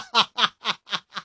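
bursting out laughing, high-pitched; a sudden, delighted laugh. Real, not acted. Host cracks up at slang.

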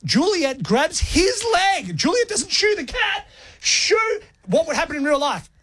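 high-pitched incredulous rant; mock outrage. Real, not acted.

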